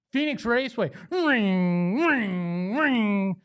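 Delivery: usual speaking voice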